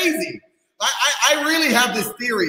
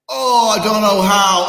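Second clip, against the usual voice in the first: mock whiny complainer; 5 dB louder, slower than usual. Mocking a lazy complainer.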